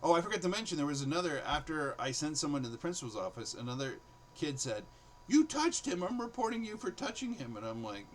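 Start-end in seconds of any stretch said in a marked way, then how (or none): 5.3-7.6 put-on a kid pointing the finger, much higher; tattling kid. Kid threatens to report teacher.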